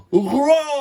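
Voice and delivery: huge high shouted roar; frightening roar. A narrator's reading. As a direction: roar to scare the lion. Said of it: "went Monsieur Tambour".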